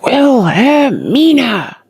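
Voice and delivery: panicked bellow to the garden. A narrator's reading. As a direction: bellow her name through the window. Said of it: "emitted a bellow".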